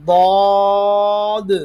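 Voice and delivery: ضاد thick, high and very loud, ending in an audible d; heavy daad. Shown as a demonstration. Teacher models for pupils, heavy long ضاد. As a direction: puff the mouth and sustain ضاد loudly. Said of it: "موٹا پڑھا جائے گا".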